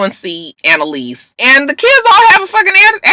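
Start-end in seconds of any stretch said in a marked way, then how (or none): 1.4-3.1 exasperated. Real, not acted.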